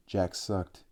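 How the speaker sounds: ordinary delivery, nothing marked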